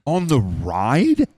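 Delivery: high, slow, rising disbelief; incredulous. Real, not acted. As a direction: draw the question out, climbing in shock. Stunned by where it happened.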